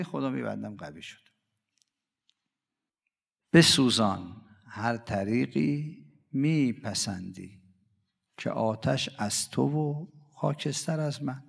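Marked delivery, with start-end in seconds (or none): 3.5-11.4 slow, drawn-out devotional verse; poem recitation. Acted. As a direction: chant each line slowly like a lament.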